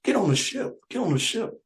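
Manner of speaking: high coaxing nun voice. Retold or imitated. Nun luring the villager onto the ship, high and coaxing. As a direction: coax him aboard in a high sweet voice. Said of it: "the nuns are telling me".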